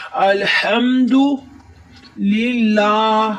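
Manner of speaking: الحمدللہ with a long drawn vowel, slow; lengthened vowel. Shown as a demonstration. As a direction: stretch the standing vowel in الحمدللہ. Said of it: "اس کو تھوڑا سا لمبا کریں گے". (کھڑی زبر کھینچ کر)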